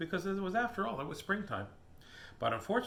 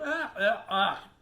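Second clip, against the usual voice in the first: high, short yelps; Megan's yell; 5 semitones higher, 4 dB louder, slower than usual. Acted. Megan sprayed in the face, high yelping. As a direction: yelp "ah" in bursts. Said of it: "Megan yelled".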